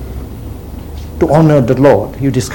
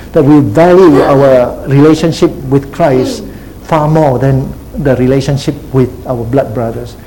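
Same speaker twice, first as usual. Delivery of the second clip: ordinary talk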